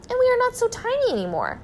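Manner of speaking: very high, overjoyed voice; gleeful exclamation. A narrator's reading. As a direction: squeal it with delight and relief. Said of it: "laughed Missus Gregg".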